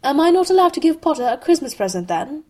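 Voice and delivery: high, loud, quick sneer. A narrator's reading. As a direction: fire back a haughty challenge. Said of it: "Malfoy sneered some more".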